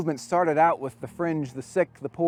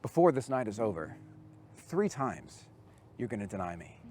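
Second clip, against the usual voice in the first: softer and lower, fading almost to nothing; solemn quiet warning; 5 semitones lower, 7 dB quieter than usual. Retold or imitated. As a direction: tell him the hard truth gently, barely above a breath. Jesus tells Peter a hard truth.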